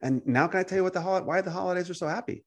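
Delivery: soft, high, casual agreement; easygoing. Acted. Believer agreeing to chat over coffee, softly easygoing. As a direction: go along lightly and gently, pitch lifted.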